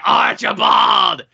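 put-on booming villain voice; the Master. Shown as a demonstration. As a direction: stretch the name and call out like a hammed-up cult master. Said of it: "I'm gonna do the Master's accent".

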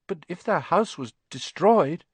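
put-on worried young boy asks. Harry anxious question.

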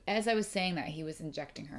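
usual speaking voice

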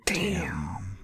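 breathy whispered damn; whispering. Real, not acted. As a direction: whisper it under your breath. Whispered reaction.

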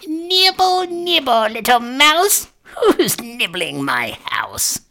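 high, drawn-out witch's call; shrill old witch, starting high and sliding down. A narrator's reading. Witch calls out from inside to nibblers, shrill. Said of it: "a shrill voice called out".

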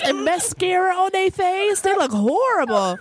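normal talking voice

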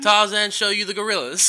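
mock British accent, put-on voice; Tarzan. Co-host spoofing failed accent tapes, mock British accent. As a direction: play a badly accented British ape-man. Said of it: "Him trying to do a British accent".

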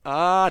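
a sudden open-mouthed aah; groan. Retold or imitated. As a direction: let out an involuntary groan. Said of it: "って言っちゃったからね".

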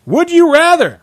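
high, drawn-up game-show call; game catchphrase. Pretend. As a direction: sing out the question, high and loud. Game catchphrase called out each round.

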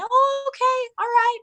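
put-on high, sing-song little kids' reply; kids agreeing. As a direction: chirp it back like obedient little kids. Kids answer mom's request.

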